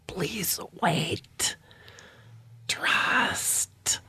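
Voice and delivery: soft whisper, broken by a long pause; otherworldly, fumbling telepathic whisper; halting mental voice. Acted. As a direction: whisper word by word, struggling to communicate. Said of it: "communication is really, sh-, like, shitty with him".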